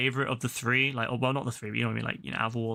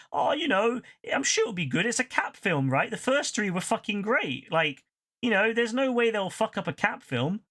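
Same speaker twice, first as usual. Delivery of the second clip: higher, naive optimistic voice; mock hopeful self; 7 semitones higher than usual. Mocking his past self's hopes before the film, higher and naive. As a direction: play your past self, sure it'll be good. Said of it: "we went to see it thinking".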